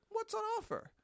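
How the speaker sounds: very high, casual question; light high-pitched polite inquiry; innocent query. Shown as a demonstration. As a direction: pitch it right up, free of innuendo. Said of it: "I said".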